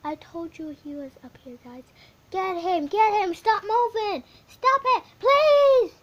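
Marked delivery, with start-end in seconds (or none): 2.3-5.9 louder, higher each line; pleading yell. Real, not acted. Kid gamer yelling at the fleeing sheep, pleading loudly. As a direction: shout at it to hold still.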